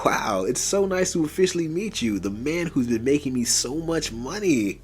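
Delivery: smiling, high and loud gushing welcome; friendly. A narrator's reading. Cartel man meets his great chemist, smiling and friendly. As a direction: greet him with big, drawn-out delight. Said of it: "smiling in a friendly tone".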